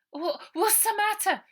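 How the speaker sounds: high startled waking question; high flustered splutter. A narrator's reading. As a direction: jolt awake confused. William startled awake.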